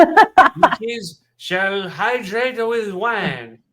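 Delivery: slow, loud pompous proclamation; hammy grand announcement, drawn out; mock pompous decree. Mock school wine decree.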